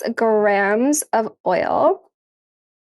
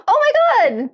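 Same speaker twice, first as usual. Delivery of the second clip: gasp, then a much higher oh my God; surprised gasp; 12 semitones higher than usual. Real, not acted. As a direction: caught off guard by the reward. Surprised by a reward.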